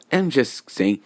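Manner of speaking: plain reading voice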